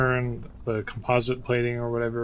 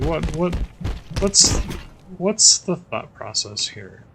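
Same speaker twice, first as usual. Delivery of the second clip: exasperated; 7 semitones higher, 4 dB louder, slower than usual. Real, not acted. Exasperated rant about weapon balance.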